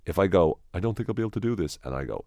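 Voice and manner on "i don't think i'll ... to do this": higher, fast worried rush; anxious negative voice. Shown as a demonstration. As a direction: gabble the doubt quickly. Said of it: "if I try to be confident".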